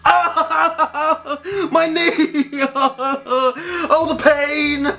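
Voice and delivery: high, laughing hammed-up agony; dramatic brother. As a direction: overplay the pain like a tragic hero. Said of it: "He could be quite dramatic".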